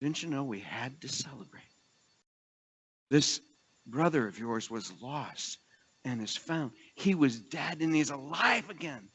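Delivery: a father gently pleading with his angry son; tender father. Retold or imitated. Father softly reasoning with his older son, tender. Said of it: "And the father says".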